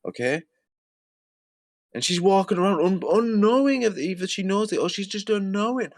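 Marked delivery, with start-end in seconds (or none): 1.9-5.9 ranting. Real, not acted. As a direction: build up an angry rant. Ranting about the woman.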